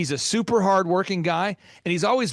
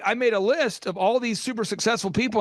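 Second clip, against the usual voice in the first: ordinary talk, nothing marked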